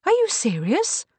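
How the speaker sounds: high, loud incredulous question. A narrator's reading. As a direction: ask it in stunned disbelief. Kate stares, stunned at the suggestion.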